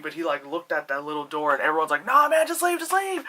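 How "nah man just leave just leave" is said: high, insistent audience plea; imitated crowd urging, loud then easing; mock urging. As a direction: plead as the crowd, pitched way up. Acting out audience urging him.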